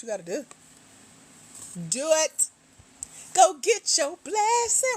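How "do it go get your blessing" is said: excited cheer. Real, not acted. Hyping up a listener.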